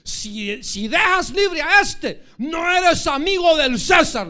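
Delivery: high, very loud, drawn-out threat; threatening shout. Acted. Crowd threatens Pilate with Caesar's displeasure, loud menacing shout. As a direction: roar it as an ultimatum. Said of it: "ellos le, lo amenazaron".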